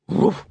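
single short bark; imitated dog bark; dog's woof. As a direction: give one brief doggy woof. Narrator voices the dog's bark.

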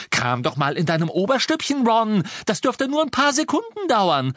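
sarcastic scream climbing very high; mock him at the top of the voice; biting sarcasm. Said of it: "schrie Hermine mit beißendem Sarkasmus".

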